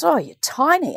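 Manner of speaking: high, offhand "you're tiny"; anaesthetist's remark. Retold or imitated. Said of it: "And he goes".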